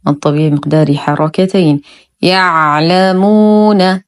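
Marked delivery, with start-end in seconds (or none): 2.2-4.0 "يعلمون" recited slowly with vowelless ع held; still ayn. Shown as a demonstration. Teacher models careful still ayn for learners, slow "يعلمون". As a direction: rest on the ayn in "يعلمون", then long ū. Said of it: "ننتبه كثيرًا لإسكان العين".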